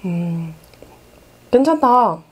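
savoring the taste; just after a sip. Real, not acted. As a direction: wet lips after drinking. Seen 2: Drank from bottle at -3 to -2; now savoring, bottle in hand.

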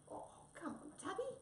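very high, squeaky wheedling; pleading little animal voice; coaxing plea. Acted. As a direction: coax a sulking friend in a tiny high voice. Friends coax cat to stay.

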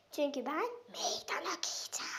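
breathy secret whisper. Acted. Whispered aside before challenge.

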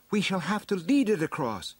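high-pitched heroine voice, making a plan; high, decisive character voice; storybook heroine. A narrator's reading. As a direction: voice the heroine well above the narration.

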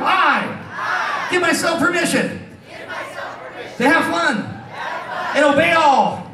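high, drawn-out crowd call; yelled oath. Shown as a demonstration. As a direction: call it out for the audience to repeat. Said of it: "Yell really loud".

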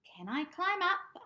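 very high, loud kitten asking; high cat voice. Acted. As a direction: ask brightly like a small cat. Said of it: "asked Pumpkin".

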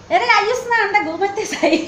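high-pitched playful Noakhali coaxing; imitating her mother. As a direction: mimic mother calling to bed, breaking into laughter. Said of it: "আমার আম্মু বলে". (আম্মুর নকল করে হাসতে হাসতে ডাকা)

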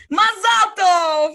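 high, loud, drawn-out cheer; mock congratulation. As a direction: imitate dad hollering congratulations. Retold dad's mock congratulation.

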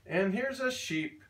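ordinary delivery

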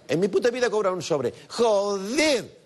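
high, fast, emphatic Basque-style swearing; vasco contundente. Acted. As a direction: swear like an emphatic Basque, building to long exclamations. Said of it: "Este es un vasco ya". (palabrotas de vasco, rotundo y agudo)

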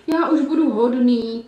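slow, pleading wail; wailing wolf. Acted. Wolf still lying on the ground, wailing his promise. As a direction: keep begging through the whimpers. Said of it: "pokračoval vlk v úpěnlivém kvílení".

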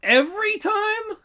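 a slow, squeaky can-you-believe-this echo; incredulous echo. Real, not acted. Echoing the co-host's blanket rule back at him, incredulous.

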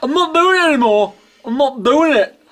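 ordinary talk

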